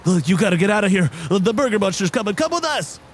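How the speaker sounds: urgent warning, rising high at the end; urgent deer Pokemon. Acted. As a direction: hurry the heroes away. GM voices the fleeing Sawsbuck.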